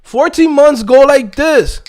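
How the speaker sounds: normal talking voice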